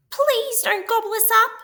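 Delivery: very high, fast pleading squeal; frightened squeal. A narrator's reading. Scared pigs beg the wolf not to eat them. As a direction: beg for mercy in a panic. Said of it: "squealed the three little pigs".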